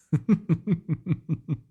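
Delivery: high, breathy gloating laugh; evil laugh. Acted. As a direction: laugh at his doom. Villain laughs at hero's fate.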